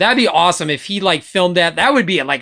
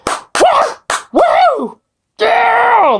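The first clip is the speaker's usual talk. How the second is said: loud whooping cheer, much higher; cheering at the fanfare; 4 dB louder, much slower than usual. Pretend. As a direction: whoop and yell like the Marvel logo just hit the screen. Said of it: "I am going to be like".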